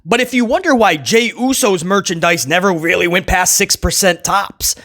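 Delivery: ordinary delivery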